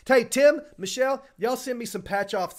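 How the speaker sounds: loud, high holler to people; calling out. Real, not acted. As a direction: call out to get their attention, high and loud. Calls out to Tim and Michelle.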